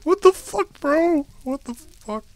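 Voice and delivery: high, loud disbelieving scream; shrieked outrage. Real, not acted. Yelling at the game for killing him, high loud shriek. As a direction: screech it in outrage.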